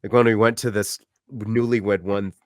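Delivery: ordinary talk, nothing marked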